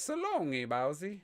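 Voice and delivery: high squeaky Mario "so long, Bowser". Retold or imitated. Telling friends how he always heard Mario, high squeaky voice. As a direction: squeal it cartoon-style. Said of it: "what I always thought he said".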